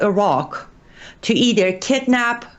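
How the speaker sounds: normal talking voice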